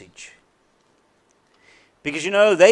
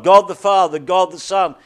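usual speaking voice

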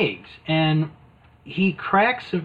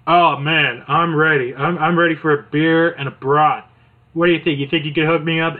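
louder, worn-out plea; hammed-up winded whine; tired Mean Gene; 4 dB louder than usual. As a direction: beg for a beer like you're exhausted. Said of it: "He's like".

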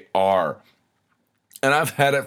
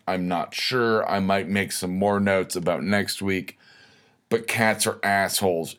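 usual speaking voice

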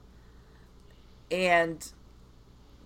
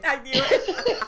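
slow, high, loud, laughing through it; 11 semitones higher, 8 dB louder, much slower than usual. Real, not acted. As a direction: crow it out while laughing, slowly. Guest guesses worker fled.

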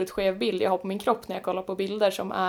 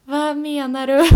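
slow, higher Swedish regional lilt, imitating a friend; imitated Swedish dialect; 4 semitones higher, 5 dB louder, slower than usual. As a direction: quote the puzzled friend in a drawn-out Swedish dialect. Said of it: "Även att du fick hans dialekt". (härmad, utdragen svensk dialekt)

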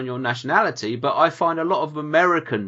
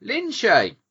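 normal talking voice

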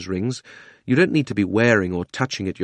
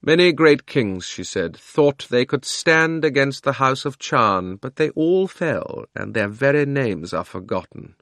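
slow, lofty scorn, higher; contemptuous; 4 semitones higher, slower than usual. Acted. Queen Jadis sneering at the boy's warning, contemptuous. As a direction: let contempt drip from every word. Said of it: "contemptuous smile".

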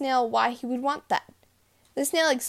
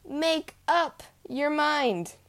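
frustrated exclaim; 6 semitones higher, much slower than usual. A narrator's reading. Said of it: "became frustrated and exclaimed".

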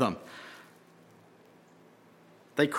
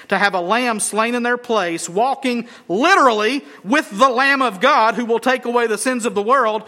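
usual speaking voice

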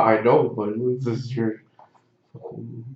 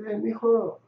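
a hint of a higher, friendly old worker; lightly voiced worker; 7 semitones higher, 3 dB quieter than usual. Taco shop worker inviting him to church, lightly imitated. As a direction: coax him sweetly toward church.

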